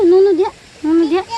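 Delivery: loud, high warning. Real, not acted. Stopping the child.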